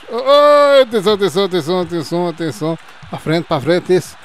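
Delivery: rising, loud urging of the play; excited calling out. Real, not acted. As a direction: cheer the move on. (chamada entusiasmada)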